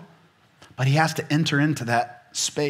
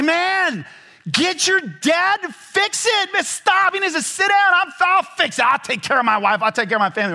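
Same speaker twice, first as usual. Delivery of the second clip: hammed-up indignant husband; mock outrage; 3 dB louder than usual. Young husband ranting at wife about her dad fixing the fence. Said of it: "And I was like".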